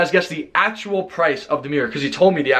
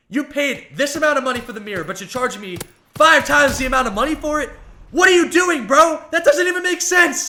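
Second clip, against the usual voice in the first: outraged; 10 semitones higher than usual. Retold or imitated. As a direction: call out the ripoff, exasperated. Retold outrage at overcharge.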